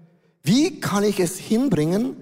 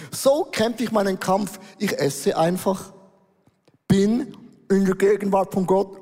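normal talking voice

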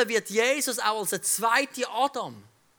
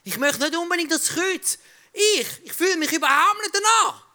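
Jesus pleading with God in Gethsemane, raised and earnest; 6 semitones higher, 4 dB louder than usual. Acted. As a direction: beg God to spare you the cup. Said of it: "Er hat gesagt".

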